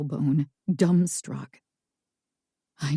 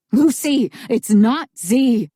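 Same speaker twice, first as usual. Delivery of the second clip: shout; 5 semitones higher, 5 dB louder than usual. A narrator's reading. Narrator yells after Lucy.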